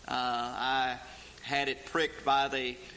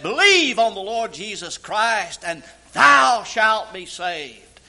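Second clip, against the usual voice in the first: loud, high preaching of the quotes; full-force preaching; 6 semitones higher, 11 dB louder than usual. Acted. Preacher to congregation quoting Paul, loud fervent preaching. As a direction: proclaim it to the back row.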